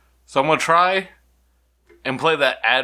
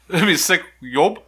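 backwards speech; 3 semitones higher than usual. Shown as a demonstration. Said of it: "That's boy kisses backwards".

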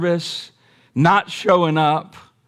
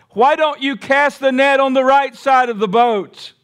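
calling out; 8 semitones higher than usual. Acted. Jesus calling to the boat.

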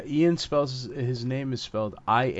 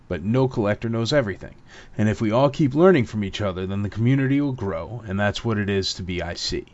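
scripted segment read. Real, not acted. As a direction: read out the show's set question, punching its opening. Host reads scripted segment question.

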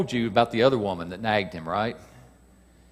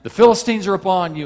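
higher, louder cry of alarm; raised-voice warning shout; acted shout; 8 semitones higher, 8 dB louder, faster than usual. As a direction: call out a sudden warning. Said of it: "And so she shouts at him".

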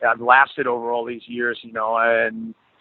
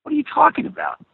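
much higher, disbelieving question; incredulous; 14 semitones higher than usual. Retold or imitated. Told Phil's wife called, asks in high disbelief. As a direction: voice jumps up in stunned disbelief. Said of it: "I was like".

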